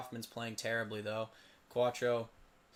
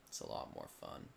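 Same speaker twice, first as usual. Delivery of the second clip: unvoiced soft whisper; 11 dB quieter, faster than usual. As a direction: breathe it as a whisper. Repeated line, whispered first.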